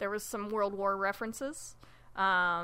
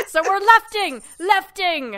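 excited shout; 10 semitones higher, 11 dB louder than usual. Real, not acted. Joking wordplay exclamation.

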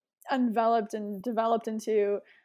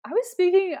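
excited burst; 9 semitones higher, 9 dB louder than usual. Real, not acted. Burst while recalling channeling.

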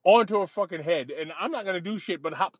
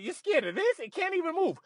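higher mocking voice for the girlfriend, teasing; fearless girlfriend; 10 semitones higher, 4 dB quieter than usual. Girlfriend mocking him for fearing the rat, high teasing voice. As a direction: scoff at his fear like she's fearless. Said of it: "She's like".

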